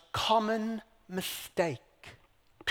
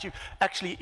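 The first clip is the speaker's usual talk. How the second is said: usual speaking voice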